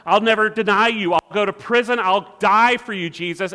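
loud, high, acted declaration; chest-banging vow. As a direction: proclaim it like Peter boasting of his loyalty. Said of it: "Peter banging on his chest saying".

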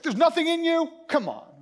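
high, loud mock exasperation; mad at fridge. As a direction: scold the empty fridge in disbelief.

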